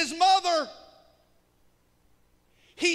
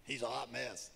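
low, soft gossiping aside; onlookers gossiping; 11 dB quieter than usual. Retold or imitated. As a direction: mutter it like a nosy neighbour. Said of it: "Everybody be like".

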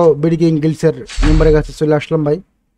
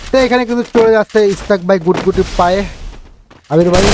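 excited esports caster; 4 semitones higher than usual. Acted. Live play-by-play of kills.

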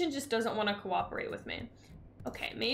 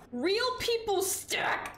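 much higher, slow, pleading on real; higher exasperated emphasis; 10 semitones higher, much slower than usual. Real, not acted. Griping to chat again, higher and drawn out. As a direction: hit real hard, half wailing. Dismayed at messy shelves.

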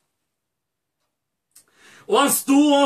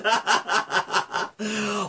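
softer, rippling laugh of delight; joyful laugh; 4 semitones lower, 7 dB quieter than usual. Acted. Father meeting his son, joyful laugh. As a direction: laugh with a reunited father's joy.

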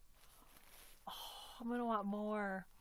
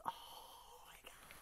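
slow awed whisper; 12 dB quieter, slower than usual. Real, not acted. Overwhelmed by the tiny doll, slow whisper. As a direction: breathe it out slowly.

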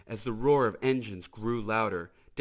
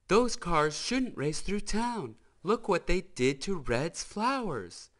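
put-on higher, scolding young woman's voice; Sally's voice; 9 semitones higher, 4 dB louder than usual. Sally complains to the sheriff, higher and scolding. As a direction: fret over the reckless racers.

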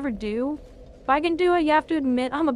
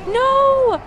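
a much higher, loud anguished no; anguished scream; 10 semitones higher, 7 dB louder than usual. Real, not acted. Gamer losing to the boss, anguished scream. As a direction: scream it as the win slips away.

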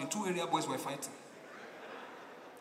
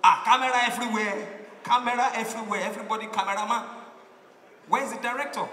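louder and higher, the middle line rushed fast; excited foreigner; 4 semitones higher, 7 dB louder, faster than usual. Retold or imitated. Visitor marvels at the crowd filming, excited and loud. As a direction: gush about the cameras everywhere.

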